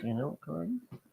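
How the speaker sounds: hushed secretive whisper. Real, not acted. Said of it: "why are you whispering to me?".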